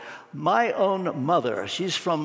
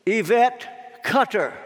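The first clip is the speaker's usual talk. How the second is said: slow, high summons, drawn out; calling out a name; 4 semitones higher, slower than usual. Acted. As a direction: announce the name solemnly to a waiting crowd. Name called from the judgment seat.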